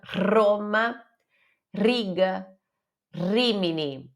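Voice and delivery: "Roma, Riga, Rimini" with a strongly trilled rolled R. Shown as a demonstration. Teacher shows students the Italian R, rolled R. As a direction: roll the tongue on each R of "Roma". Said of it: "Você vai precisar enrolar a língua".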